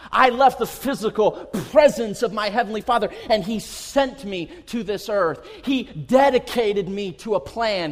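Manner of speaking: loud, ringing declaration as Jesus; emphatic preaching. Acted. Jesus explaining his mission to disciples, proclaimed forcefully. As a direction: proclaim each phrase forcefully.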